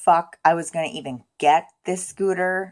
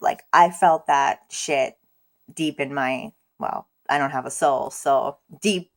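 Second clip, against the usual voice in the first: ordinary talk, nothing marked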